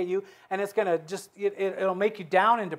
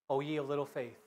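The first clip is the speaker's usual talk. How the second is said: gentle rebuke; 4 semitones lower, 11 dB quieter than usual. Acted. Jesus's reply voiced.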